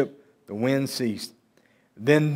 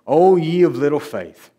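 louder, mild rebuke; rebuking; 2 semitones higher, 5 dB louder than usual. Acted. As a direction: reprove him firmly without scolding. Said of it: "he's rebuking him".

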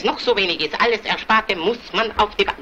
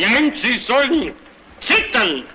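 higher, drawn-out outburst; angry threat; 5 semitones higher, slower than usual. Acted. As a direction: cut them off with a threat. Quarrel, threatening the colleague. (wütend und gedehnt drohend)

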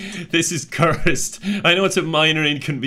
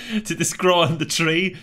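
laughing; 5 semitones higher than usual. Real, not acted. Surprised laugh at strange item.

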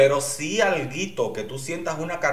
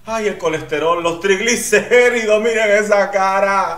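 overwhelmed excitement; 7 semitones higher, 8 dB louder than usual. Real, not acted. Overwhelmed by contestant's face.

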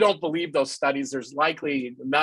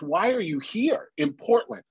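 a touch of exasperation, pitched higher; mildly exasperated; 6 semitones higher than usual. Retold or imitated. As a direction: ask it fed up but holding back. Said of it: "almost exasperated".

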